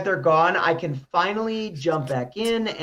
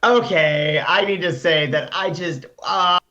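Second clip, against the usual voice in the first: ordinary delivery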